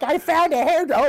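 high mom voice, a touch excited; imitating his mom. Son retelling his mom's phone news, high mom voice. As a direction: lift the pitch into a chatty mom. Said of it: "my mom's like".

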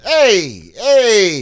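high, loud mock calls of hey; calling out. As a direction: shout him down like fellow fans. Fans calling out to stop him.

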